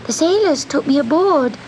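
high, helpless little cat; kitten voice. Acted. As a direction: plead your innocence, small voice. Kitten figure answers.

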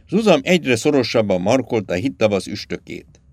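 plain reading voice

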